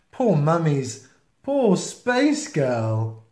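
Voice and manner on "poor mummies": ordinary delivery, nothing marked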